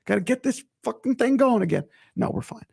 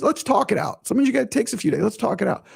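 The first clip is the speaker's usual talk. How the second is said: normal talking voice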